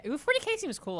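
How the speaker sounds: high, bright "Ooh, forty K"; light up at the idea; excited. Real, not acted. Repeats the name with delight.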